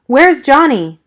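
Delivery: high, loud frightened cry. A narrator's reading. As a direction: call out scared for a friend. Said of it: "I cried, frightened".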